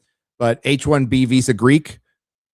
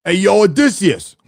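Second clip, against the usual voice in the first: high, loud mock Brooklyn accent calling out; playful Brooklyn accent street greeting, pitched up; 8 semitones higher, 5 dB louder than usual. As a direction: hail Odysseus in a hammed-up Brooklyn accent. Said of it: "like, the Brooklyn accent".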